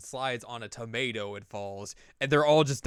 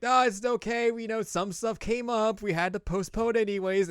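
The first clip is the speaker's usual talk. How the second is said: higher, sugary reassurance; sweet girls' voice; 11 semitones higher, 4 dB louder than usual. Acted. Yukiko and Chie telling Dojima it's fine: high sugary voice. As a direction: coo it like nothing's wrong.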